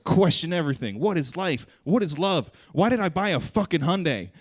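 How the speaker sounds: a car ad in existential crisis; mock existential ad. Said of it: "It's like".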